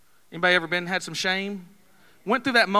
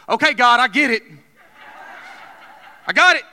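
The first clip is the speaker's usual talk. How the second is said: loud, high, laughing surrender; shouting to God with a laugh; excited realization; 4 semitones higher, 5 dB louder than usual. Real, not acted. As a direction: throw up your hands and laugh out loud. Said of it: "realizes God's message".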